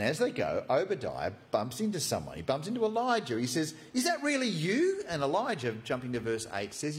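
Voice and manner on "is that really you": high, startled question; as if seeing a ghost; astonished disbelief. Acted. Obadiah stunned to bump into Elijah, high astonished.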